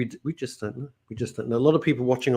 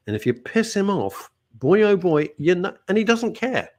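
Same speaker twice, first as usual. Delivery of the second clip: normal talking voice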